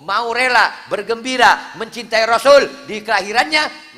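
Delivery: high, loud sermon delivery; full-force preaching. Acted. Preacher urging the congregation, loud and high. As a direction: preach it out to the crowd.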